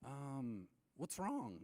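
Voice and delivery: hesitant, drawn-out innocent question; clueless idiot. Retold or imitated. Asking the silent caller what's up, clueless idiot. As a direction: play dumb with a lilting question. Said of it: "like an idiot does".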